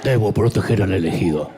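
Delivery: deadpan movie robot; Terminator voice. Retold or imitated. Jesus imagined as Terminator, low robotic drone. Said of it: "como Terminator".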